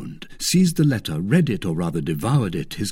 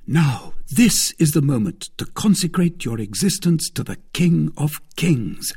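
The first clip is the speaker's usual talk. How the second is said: lightly mock-solemn, higher and louder; teasing D'Artagnan; 7 semitones higher, 4 dB louder than usual. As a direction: tease with a touch of pulpit grandeur. D'Artagnan mocks Aramis's piety.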